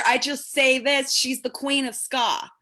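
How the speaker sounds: ordinary delivery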